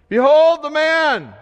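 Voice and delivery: high, loud, drawn-out announcement; loud proclamation. Acted. Pilate presenting Jesus to the crowd, loud proclamation.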